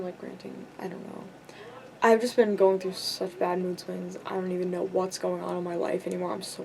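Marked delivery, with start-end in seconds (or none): 0.0-1.2 trailing off into a near whisper. Real, not acted. Vlogger tiredly admitting she has been ranting, whispered. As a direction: let it fade to breath.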